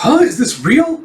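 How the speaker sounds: high, rising question; stunned disbelief. Retold or imitated. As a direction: imitate someone reeling from bad news. Acted shock at the expulsion.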